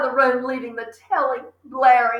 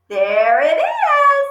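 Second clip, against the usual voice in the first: put-on proud little boy; 5 dB louder, much slower than usual. Fred proudly shows his parrot.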